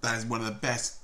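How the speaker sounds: talking between bites; while chewing. Real, not acted. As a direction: mouth partly full. Seen 1: bites cake at 0.1, chews early, then talks holding it.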